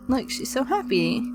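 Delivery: ordinary delivery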